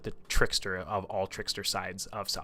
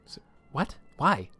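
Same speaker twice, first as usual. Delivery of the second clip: higher, startled what and why; baffled; 6 semitones higher than usual. Retold or imitated. Hanlon asks Twitch why he'd come, mild mock surprise. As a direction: caught off guard, mildly incredulous.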